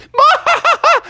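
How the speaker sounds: very high squeaky laugh; high mocking cackle. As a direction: cackle up high like a gloating skunk. Stinky laughs.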